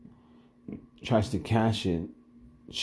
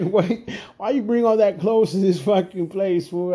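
high, louder exasperated questioning; retold scolding; 8 semitones higher, 4 dB louder than usual. Bouncer berating partner for bringing clothes to work, exasperated. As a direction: lay into him with a raised, disbelieving voice.

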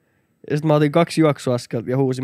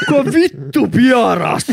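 louder, higher, slow laughing mock outrage; mock outraged bystanders; 7 semitones higher, 5 dB louder, slower than usual. People in the bar queue asking who farted, mock outrage. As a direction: ham up the shocked crowd. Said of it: "kolme ihmistä samaan aikaan oli".

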